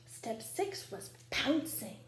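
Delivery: ordinary delivery, nothing marked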